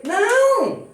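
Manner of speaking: sudden high, loud refusal; proud man's shout. Acted. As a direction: shout the no like a spoiled proud man.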